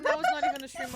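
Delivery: high, fast and loud; excited squeal. Real, not acted. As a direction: blurt it out in disbelief. Laughing at funniest thing.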